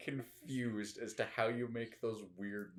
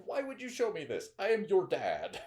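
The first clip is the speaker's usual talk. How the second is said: outraged dad; 9 semitones higher, 7 dB louder than usual. Retold or imitated. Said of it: "He's like".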